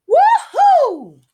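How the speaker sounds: very high, loud cheer of freedom; an escaped beast cheers its freedom, high and loud; joyful whoop. Acted. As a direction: whoop like you just broke free.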